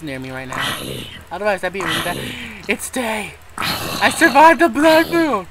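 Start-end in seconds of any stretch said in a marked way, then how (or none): 3.6-5.5 slow, high and loud victory call; proud gamer crowing over surviving the night; triumphant cheer. Real, not acted. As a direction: savour the win, stretching it out. Triumph as day breaks.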